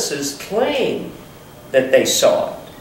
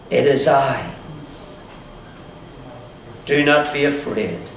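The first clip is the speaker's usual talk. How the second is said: soft, gentle words of comfort; calm reassurance; 5 semitones lower, 5 dB quieter than usual. Acted. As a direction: soothe frightened men gently. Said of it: "But he said to them".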